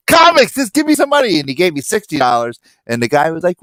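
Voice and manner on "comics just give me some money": high-pitched, hammed-up urgency; mock-frantic insistence, pitched way up; impatient demand. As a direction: burst out as if begging for cash this second. Hurried money demand acted out.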